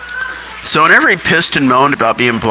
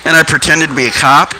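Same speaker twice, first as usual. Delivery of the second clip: normal talking voice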